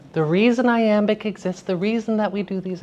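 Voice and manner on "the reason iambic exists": ordinary delivery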